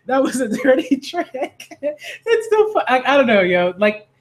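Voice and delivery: high, squeaky words breaking into laughter; helpless laughing. Real, not acted. Reader loses it at the milk joke, real laughter. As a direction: try to read on while cracking up. Reader cracks up mid-reading.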